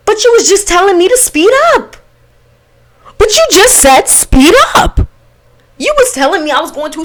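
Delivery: high, loud indignant retort; high-pitched, heated comeback; exasperated protest. Retold or imitated. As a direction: protest loudly, pitch climbing way up. Acted protest at his contradiction.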